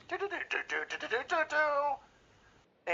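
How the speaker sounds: high sung horn tune; imitating the Dixie car horn; General Lee horn. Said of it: "the General Lee horn".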